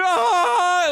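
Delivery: high, drawn-out bawling scream; mock screaming wail. Student melting down mid-exam, imitated screaming cry. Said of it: "like, screaming".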